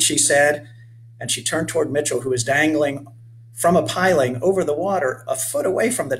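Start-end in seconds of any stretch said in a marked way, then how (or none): none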